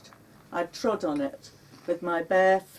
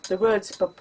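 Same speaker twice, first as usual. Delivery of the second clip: ordinary talk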